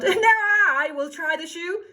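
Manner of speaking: high, loud demand; yelling. Acted. Second sister yelling to try the shoe, high and loud. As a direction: bawl it out pushily. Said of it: "who yelled".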